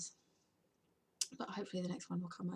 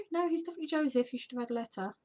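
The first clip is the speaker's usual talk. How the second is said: quick, higher-pitched firm correction; retold insistence; 6 semitones higher, 6 dB louder, faster than usual. Teacher tells mum he is definitely Joseph, insistent. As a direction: imitate someone briskly putting a parent right.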